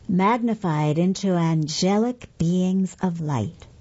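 ordinary delivery, nothing marked

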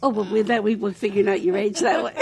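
usual speaking voice

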